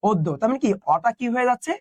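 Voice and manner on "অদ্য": অদ্য spoken as "oddo", first vowel ও; pronunciation demo. Shown as a demonstration. Teacher to students, modelling অদ্য pronunciation. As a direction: repeat অদ্য slowly with a rounded o. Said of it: "এটার উচ্চারণ হচ্ছে অদ্য".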